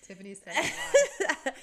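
loud, slow, laughing jab; teasing a friend mid-story, laughing loudly; laughing tease. Real, not acted. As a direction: laugh it out as a playful accusation.

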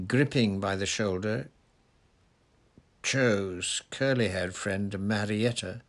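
usual speaking voice

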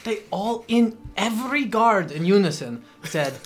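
ordinary talk

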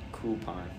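coupon said "KOO-pon", low and soft; coupon without y. Shown as a demonstration. Host trying the other way, coupon as "KOO-pon". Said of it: "That sounded more natural".

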